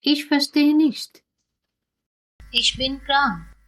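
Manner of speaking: usual speaking voice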